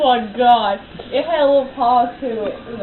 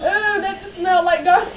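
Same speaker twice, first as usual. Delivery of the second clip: laughing; 7 semitones higher than usual. Real, not acted. Laughing after a burp.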